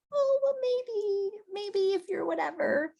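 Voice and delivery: high, drawn-out mock dithering; wishy-washy hesitation. Coach contrasts a weak invite with a sure one, wishy-washy. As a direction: waver in a small, high voice. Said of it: "You see the difference in that?".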